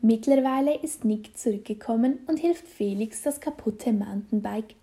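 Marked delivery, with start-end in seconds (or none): none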